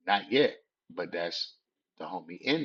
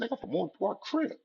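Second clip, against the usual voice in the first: fast, much higher defiant answer; defiant retort; faster than usual. Retold or imitated. Teen repeating his set name, fast high defiance. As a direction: fire it back without backing down.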